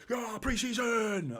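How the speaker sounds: high, loud, drawn-out shout of yeah, pre-season; mock hyped-up pre-season cheer. Mocking a chat viewer who's wired for pre-season, big shout. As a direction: ham up being way too fired up for a friendly. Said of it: "I was like".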